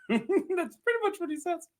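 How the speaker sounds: high, soft voice through laughter; laughing. Real, not acted. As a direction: squeeze it out while laughing. Breaks up at own retelling.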